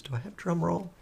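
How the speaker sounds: ordinary delivery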